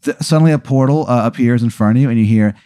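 ordinary talk

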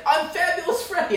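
high, loud mock Joe Pesci; imitating Joe Pesci as Fabulous Freddy. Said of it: "Joe Pesci and he was like".

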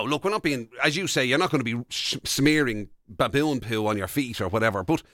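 ordinary talk, nothing marked

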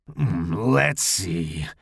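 low, rough growl. A narrator's reading. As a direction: growl it low, trying out a new voice. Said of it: "Larry growled".

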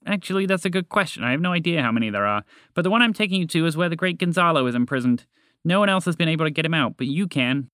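quick, keen storybook toucan; lightly voiced toucan guide. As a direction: explain briskly as the toucan guide.

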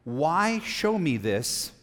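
WHY stretched long, phrase very slow; drawn-out emphasis. A narrator's reading. Preacher pointing out the key question, drawn-out stress on Why. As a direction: lean slowly on "Why", holding it out.

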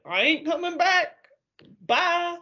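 high, flippant mock tantrum; mock defiant exit. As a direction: storm off in a comic high voice. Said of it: "he basically is like".

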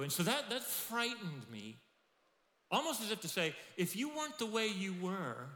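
whining excuse. Retold or imitated. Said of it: "as a result".

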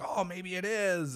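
put-on high, eager boy voice; light up with delight; excited. Boy excited about his gift, high and eager.

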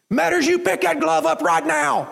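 high, slow furious bark; coach barking. Retold or imitated. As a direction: bark the order at a hurt kid. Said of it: "I can hear him barking".

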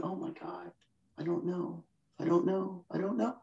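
breathless, frantic whispered I don't know; panicked whisper. Retold or imitated. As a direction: whisper it like someone losing it. Voicing a panicking emotional mind.

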